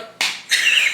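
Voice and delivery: breathy burst of real laughter; laughing. Real, not acted. Reader cracking up over a cliché, real laughter. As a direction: crack up mid-rant. Laughs at her own annoyance.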